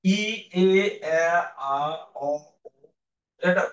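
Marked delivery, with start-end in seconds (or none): 0.1-2.3 quick clipped vowels in sequence; vowel series demo. Shown as a demonstration. Teacher demonstrating the vowel circle. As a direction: run through the vowels, tongue sliding down. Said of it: "এই serial উচ্চারণ করবা".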